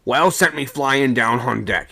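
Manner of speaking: a seaman shouting in anger aloft; angry shout. A narrator's reading. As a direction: yell at the man below. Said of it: "he shouted".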